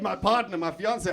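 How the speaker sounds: ordinary talk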